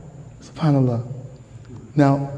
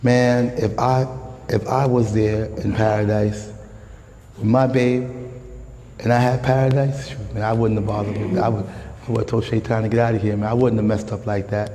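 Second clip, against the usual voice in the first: imitating a boastful believer; 6 semitones lower, 4 dB quieter than usual. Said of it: "some of us may say".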